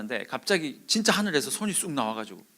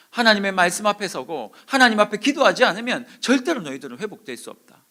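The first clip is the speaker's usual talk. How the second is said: loud, raised, emphatic declaration; stern warning; 7 semitones higher, 9 dB louder than usual. Retold or imitated. Preacher relaying God's warning to the young, emphatic. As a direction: hit it hard, easing at the end.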